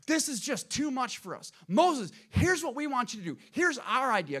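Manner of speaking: lightly acted crowd pleading with their leader; protesting Israelites. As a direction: press an urgent proposal on Moses. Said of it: "this is what the children of Israel said".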